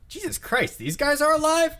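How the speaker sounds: high, loud disbelief; astonished archer. Acted. Archer runs in and sees survivors, astonished.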